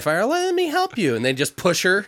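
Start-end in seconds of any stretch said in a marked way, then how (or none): 0.3-1.1 high, syrupy offer of help; sweetly fake-helpful; chirpy fake helper; mock sweet helper.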